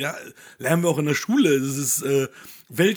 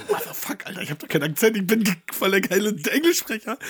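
whispered then higher indignant protest; mock outrage; 7 semitones higher, 3 dB louder than usual. Recalling being told of his accent, mock indignant. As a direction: act offended at having an accent. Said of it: "Und ich so".

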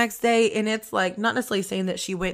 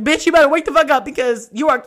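loud, higher frantic scolding; scolding oneself; 5 semitones higher, 7 dB louder than usual. Retold or imitated. Medium tells herself off in bathroom mirror, frantic scolding. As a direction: give yourself an urgent telling-off.

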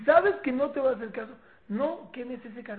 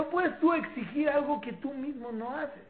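ordinary delivery

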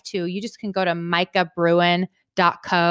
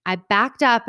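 normal talking voice

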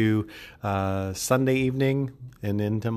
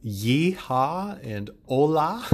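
hammed-up cowboy yeehaw; 5 semitones higher, much slower than usual. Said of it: "I'm gonna say".

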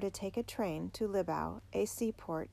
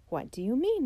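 startled question, louder and higher; greatly surprised; 6 semitones higher, 6 dB louder than usual. A narrator's reading. Uncle abruptly disturbed by the boy's news, greatly surprised. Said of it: "greatly surprised to be so abruptly disturbed".

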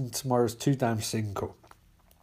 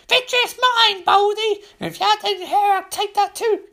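very high, squeaky gloating voice; gloating greedy creature; 4 dB louder than usual. Acted. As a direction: crow with greed, ending in a cackle. Larfleeze gloats, stealing from Luthor.

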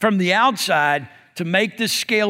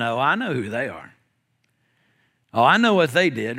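lower, mock-knowing aside; knowing gossip; 7 semitones lower than usual. Churchgoer as soon as somebody walks in, mock gossipy. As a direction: imitate a gossip sizing someone up. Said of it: "churches are notorious as soon as somebody walks in".

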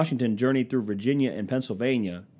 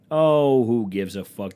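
exasperated shout; 10 dB louder, slower than usual. Real, not acted. Repeats the dismissal, shouted.

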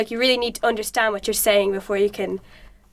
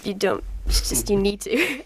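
ordinary talk, nothing marked

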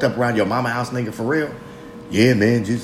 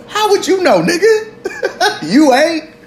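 high, laughing jab at himself; mocking himself, laughing high; laughing falsetto mockery; 4 dB louder than usual. Real, not acted. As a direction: squeal it through laughter.

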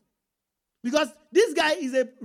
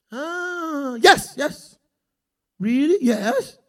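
mock overjoyed Peter; 5 dB louder, much slower than usual. Peter voiced, overjoyed at news.

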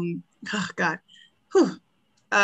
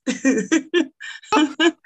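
laughing; 6 semitones higher than usual. Real, not acted. Laughs at reading lyrics.

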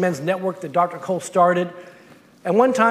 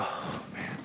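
breathy whispered "Oh, man" of dread; weary whispered groan; 18 dB quieter than usual. Retold or imitated. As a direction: sag into a defeated whisper. Dreading the trip, miserable.

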